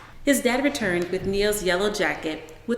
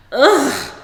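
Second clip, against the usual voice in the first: disgusted ugh; 7 semitones higher, 10 dB louder than usual. Acted. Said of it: "shuddering again".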